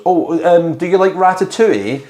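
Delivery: high, perky hostess voice; Diana the host. Acted. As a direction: lift it up high, offering politely. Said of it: "and then she goes".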